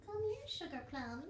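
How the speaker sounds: high cooing call to a pet; pet-talk coaxing. Real, not acted. Calling a pet over.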